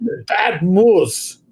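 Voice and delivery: put-on slow Russian-accented scolding; drawn-out hammy Russian accent; Boris Badenov. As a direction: scold like a cartoon Russian villain. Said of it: "doing my Boris Bedinov".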